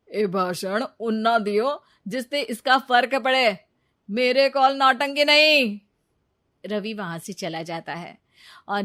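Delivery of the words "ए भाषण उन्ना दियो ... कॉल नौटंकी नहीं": louder, cold brush-off; dismissive scorn. Acted. Ravi dismissing Neeta's lament, scornful. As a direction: wave her speech away.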